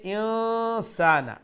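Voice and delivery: ইনসানা high and drawn out, n hummed; ikhfa demo. Shown as a demonstration. Teacher modelling the two words, ইনসানা with long nasal n. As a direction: hold the hidden n in ইনসানা before সানা. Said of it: "নুন সাকিন ইখফা".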